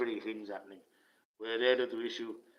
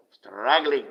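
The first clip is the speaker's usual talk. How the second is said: louder mocking struggling; loud sarcastic echo; 9 dB louder than usual. Real, not acted. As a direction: spit the word out in scorn. Sarcastic repeat.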